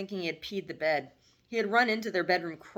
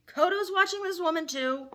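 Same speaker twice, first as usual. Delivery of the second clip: pitched-up shocked question from mom; shocked mother; 12 semitones higher, 3 dB louder than usual. Acted. Mother learns her son's friend watches too, shocked. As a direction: catch her off guard, voice jumping high. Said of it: "Betty was shocked".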